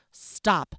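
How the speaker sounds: loud firm order; commanding. Acted. Narrator ordering the quarreling family to stop, loud firm command. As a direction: cut the quarrel off with authority. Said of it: "I commanded".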